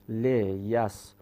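"لیسررن" slowly split as le, yas, ro, ranna; "لیسررن" broken apart to show the ro; syllable by syllable. Shown as a demonstration. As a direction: sound out "لیسررن" in separate slow pieces. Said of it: "این یه رو داریم فقط".

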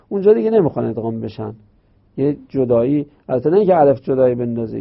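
ordinary talk

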